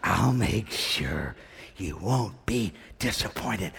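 very slow, low and soft; measured, low, soft promise; hushed scripture quote. Retold or imitated. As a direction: let each word land quietly. Hushed scripture quote after calming down.

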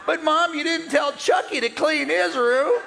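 high whining complaint to Jesus; whiny sister. Acted. Martha whining to Jesus about her sister. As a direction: whine like a put-out younger sister.

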